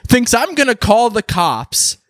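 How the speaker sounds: high, slow incredulous comeback; indignant retort. Acted. Man answering his ex's demand, high indignant disbelief. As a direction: stretch each phrase in mock outrage. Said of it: "and I go".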